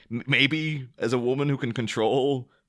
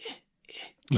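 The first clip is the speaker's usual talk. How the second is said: tiny, hushed mock grunts; little noises under breath; 6 dB quieter than usual. Extras play fighting in silence, little noises under breath. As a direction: imitate stifled fight noises, very softly. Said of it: "making little noises under your breath".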